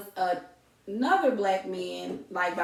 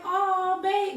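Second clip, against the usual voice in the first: high, breathy delight at the surprise; delighted gasp; 10 semitones higher than usual. Retold or imitated. Wife gushing to husband over flowers, high and delighted. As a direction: gush like a wife finally getting flowers.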